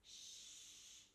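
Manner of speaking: long, soft shush; gentle "shh"; whispered shush. A narrator's reading. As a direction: hush them under your breath. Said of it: "she whispered".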